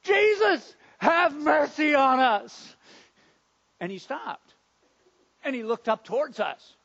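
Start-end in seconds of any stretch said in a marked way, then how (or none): 0.0-2.8 loud, high, drawn-out cry for mercy; calling desperately from far off; desperate shouting. Acted. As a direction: shout it out, pleading to be noticed. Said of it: "jumping and waving and shouting".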